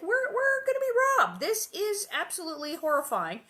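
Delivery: high, loud hammed-up alarm; over-the-top mock fright; mock panic. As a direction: play the frightened mother big. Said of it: "Caroline's like".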